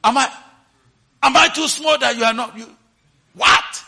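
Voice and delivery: loud indignant yell, high; angry shout. Retold or imitated. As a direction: shout it out in offense. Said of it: "Shout out and say".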